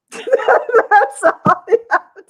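high, slow words squeezed through laughter; laughing. Real, not acted. Laughing at her own zombie advice.

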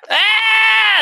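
long, high, loud freak-out scream; mock scream. Cartman freaked out by the ghost, long mock scream. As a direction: imitate a terrified cartoon shriek. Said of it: "freaked out Cartman".

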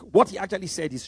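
normal talking voice

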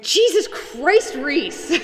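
high, loud, drawn-out cry; exasperated outburst. Acted. Player voices Savannah with Reese shoved at her, exasperated yell. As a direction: yell it slowly in hammed-up exasperation. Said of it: "she'll just be going".

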